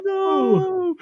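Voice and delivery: very high, loud drawn-out no; mock dismay. Real, not acted. Echoing partner's dismay.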